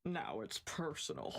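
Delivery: low, deep heroic one-liner; blonde American hero voice. Acted. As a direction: growl it like an action hero. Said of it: "my best blonde American hero voice".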